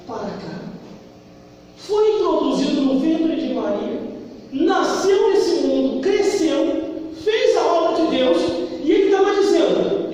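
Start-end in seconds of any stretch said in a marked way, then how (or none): none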